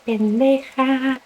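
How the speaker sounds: slow, drawn-out muttered insult in a different voice; a mom mildly imitated cussing out her daughter in public; furious Spanish cuss. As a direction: spit out the insult slowly under your breath, mock-angry. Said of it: "She got red and she was furious".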